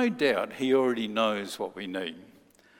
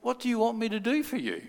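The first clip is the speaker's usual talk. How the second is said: high, quick, gentle question; Jesus asking; 9 semitones higher, faster than usual. Acted. Jesus asking the blind man what he wants, high and quick. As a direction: lift the voice and ask kindly. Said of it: "He said".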